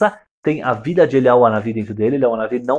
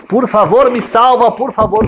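high, loud desperate begging; desperate plea; 9 semitones higher, 5 dB louder than usual. Acted. Widow begs the prophet to save her dying son, desperate high plea. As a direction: beg like a mother losing her child.

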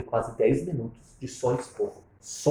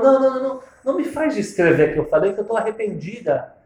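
higher and louder, pleading; higher pleading insistence; insistent apology; 8 semitones higher, 7 dB louder than usual. Retold or imitated. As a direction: press the apology. Mother-in-law insisting on apology.

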